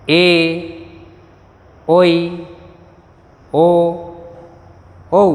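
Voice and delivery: हर्षोई said hrosh-oi, with Bengali o sound; Bengali vowel names. Shown as a demonstration. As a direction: call out each Bengali vowel for students to repeat. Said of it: "पुनः आप मेरे साथ पढ़ें".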